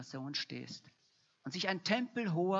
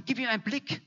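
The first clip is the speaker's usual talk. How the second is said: loud prayer; 6 semitones higher, 7 dB louder than usual. Retold or imitated. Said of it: "und er sagte".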